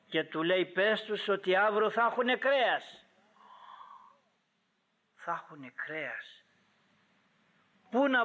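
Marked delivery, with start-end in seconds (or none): 0.6-3.0 raised, declaring voice; acted divine announcement, pitched up; firm announcement. God's announcement, then echoed quietly. 5.1-6.4 quiet, wondering repeat; hushed echo. Real, not acted. Preacher echoes the promise quietly.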